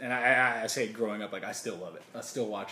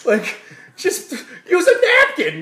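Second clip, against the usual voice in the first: shrill loud outraged yell with a laugh; Bill Burr rant; 18 dB louder than usual. Retold or imitated. Bill Burr yelling at napkin-less eater, outraged. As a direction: scold like a disgusted comic. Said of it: "He's like".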